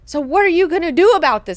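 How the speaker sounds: high, loud, quick mock demand; hammed-up impatient question. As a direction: push the problem onto them in one rush. Same demand, rushed then stressing YOU.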